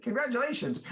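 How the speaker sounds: high, cooing wedding-guest cheer; gushing congratulations. Acted. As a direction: coo it high, as a background extra. Background extras cheering a wedding.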